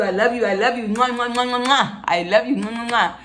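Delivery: loving words with kiss sounds; between kisses. Real, not acted. Blowing kisses to men.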